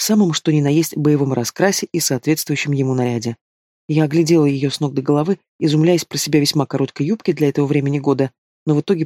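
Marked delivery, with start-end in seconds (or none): none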